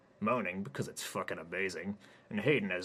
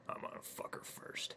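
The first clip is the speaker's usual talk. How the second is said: whisper; 8 dB quieter than usual. Acted. Whispered threat to coworker.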